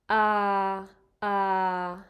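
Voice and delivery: "ah" held level at mid pitch, like a robot; a flat, steady "ah" in the middle of the voice. Shown as a demonstration. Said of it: "So that's middle pitch".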